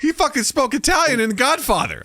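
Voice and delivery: high-pitched comic outrage; indignant, high exclamation; incredulous protest. Real, not acted. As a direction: protest in disbelief, pitch shooting up. Mock outrage over Pacino's accent.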